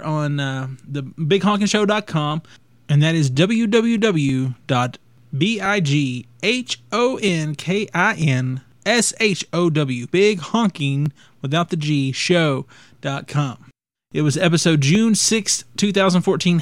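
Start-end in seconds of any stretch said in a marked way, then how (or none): 3.3-13.6 slow spelling out. Real, not acted. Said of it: "spelling out web address".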